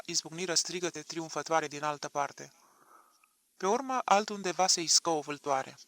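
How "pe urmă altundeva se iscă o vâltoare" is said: ordinary delivery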